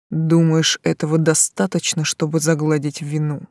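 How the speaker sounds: low, eerie voice from the grave; sepulchral voice. A narrator's reading. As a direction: make it chill the spine. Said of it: "жутким загробным голосом".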